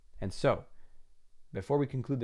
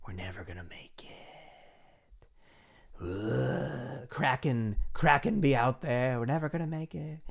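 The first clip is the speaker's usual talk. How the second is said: whispered, then a croaking sailor's moan about the Kraken; doubt monster; 2 semitones higher than usual. Pretend. Doubt monster sailor moaning to the crew, whispered then croaking. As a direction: hiss like a doomed old sea dog. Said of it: "the doubt monster, it's saying".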